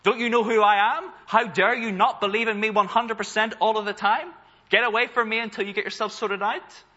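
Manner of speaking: an imagined furious Jesus berating a doubter; outraged rebuke. Pretend. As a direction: thunder it like an offended master. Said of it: "Does Jesus get outraged at this moment and say".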